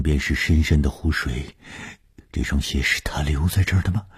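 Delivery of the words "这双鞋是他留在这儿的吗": usual speaking voice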